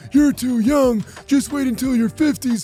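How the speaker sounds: put-on know-it-all elder, stretching the final warning; dismissive teacher. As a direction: brush off a student's complaint, hammed-up. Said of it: "which our teacher heard and said".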